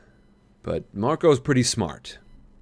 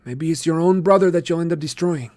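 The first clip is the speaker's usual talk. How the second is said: lightly voiced sly boy; 8 semitones higher, 5 dB louder than usual. A narrator's reading. Marco voiced in story.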